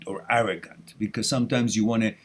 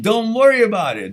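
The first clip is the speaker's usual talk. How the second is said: louder, higher, laughing mock Italian accent; 9 semitones higher, 8 dB louder than usual. Host jokingly tells a viewer not to worry, in mock Italian accent. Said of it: "as the Italians say".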